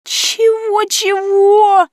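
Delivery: very high, loud squeak; shocked squeal. A narrator's reading. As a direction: shriek in total shock. Heroine's shocked reaction.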